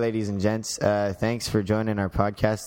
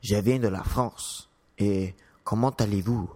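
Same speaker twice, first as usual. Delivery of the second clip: French as spoken in France; France French accent. Shown as a demonstration. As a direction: switch to a metropolitan French accent. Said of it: "And then French French".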